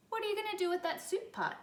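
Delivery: high, fast, eager bunny voice; small bunny asking the varmint, high and quick. Acted. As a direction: rattle it off high like a curious little rabbit. Buddy's eager question.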